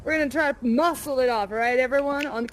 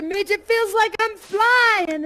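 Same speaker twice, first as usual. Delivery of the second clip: slow, louder, higher joyful call; joyful yell; 8 semitones higher, 6 dB louder, slower than usual. Retold or imitated. Yelling to sister Midge after the ride, joyful call. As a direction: call out to the sky in delight. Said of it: "I yelled to my sister".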